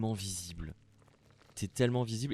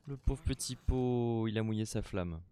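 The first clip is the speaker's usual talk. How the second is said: tender whisper; 4 semitones higher than usual. Real, not acted. Pity for character.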